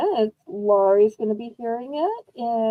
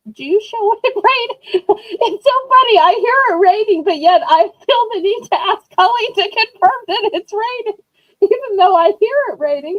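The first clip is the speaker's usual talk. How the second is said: laughing; 7 semitones higher, 6 dB louder than usual. Real, not acted. Amused at herself about the rain.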